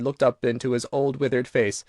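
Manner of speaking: plain reading voice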